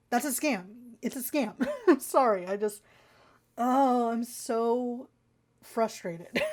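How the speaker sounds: ordinary delivery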